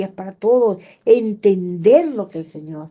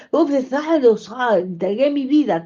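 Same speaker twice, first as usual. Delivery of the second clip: ordinary talk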